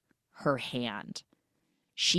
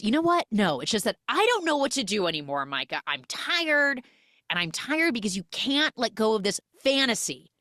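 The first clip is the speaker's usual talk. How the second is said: acted exasperation; 6 semitones higher than usual. Husband tired of his wife's fears, acted exasperation. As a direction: let fed-up frustration spill out, imitating.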